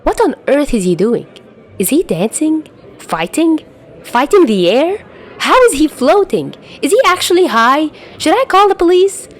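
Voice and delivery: babbling in rising alarm; panicked. Acted. Watching a floating fight.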